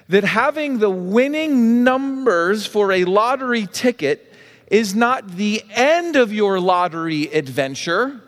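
normal talking voice

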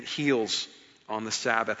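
normal talking voice

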